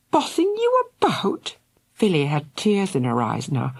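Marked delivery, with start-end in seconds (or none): 0.1-1.6 very high, stunned question; can't believe what he said; hurt disbelief. A narrator's reading. Shocked sister.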